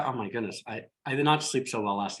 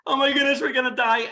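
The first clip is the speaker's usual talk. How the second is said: high, loud frightened cry, imitated; hammed-up terror of a passenger; mock panicked yell; 11 semitones higher, 5 dB louder than usual. Retelling a panicked passenger in turbulence, mock high yell. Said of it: "one person was yelling".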